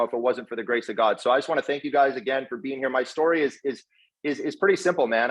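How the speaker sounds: ordinary talk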